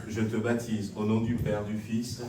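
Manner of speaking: ordinary delivery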